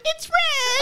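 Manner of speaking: high, laughing it's red; laughing squeal. Retold or imitated. Woman retelling staring at the bite, squeals through laughter. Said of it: "I'm like".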